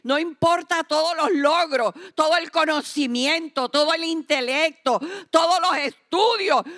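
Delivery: predica cada vez más fuerte hasta el grito; predicación fervorosa. Acted. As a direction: sube la fuerza frase a frase como en el púlpito. Predicación creciente. (predicadora que culmina gritando)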